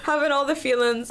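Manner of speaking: high, self-mocking wail. Real, not acted. Guest mocking her tears at the seminar, high and exclaiming. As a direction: pitch it up, making fun of yourself. Laughing at her own crying.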